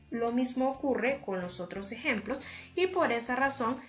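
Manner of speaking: ordinary talk, nothing marked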